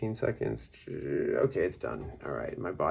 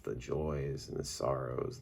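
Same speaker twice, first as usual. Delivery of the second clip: ordinary talk, nothing marked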